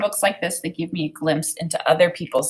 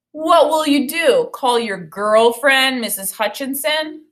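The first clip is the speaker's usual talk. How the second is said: mocking bully; 6 semitones higher, 3 dB louder than usual. A narrator's reading. Bully taunts Omar.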